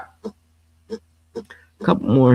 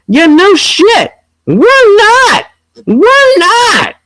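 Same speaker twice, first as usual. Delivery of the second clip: exasperated high retort; 14 semitones higher, slower than usual. Real, not acted.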